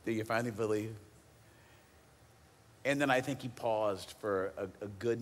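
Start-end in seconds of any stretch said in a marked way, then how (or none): 0.0-0.9 wry, dry, smirking question; side eye and smirk. Retold or imitated. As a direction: tease them gently with a smirk. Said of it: "with a little bit of a side eye and a smirk".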